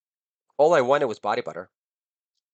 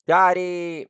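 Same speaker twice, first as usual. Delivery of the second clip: mock child plea; 7 semitones higher, 6 dB louder than usual. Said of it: "She's like".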